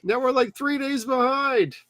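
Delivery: high-pitched nagging gnome boss; imitated Carl. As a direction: scold the crew in a high put-on voice. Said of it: "and he's like".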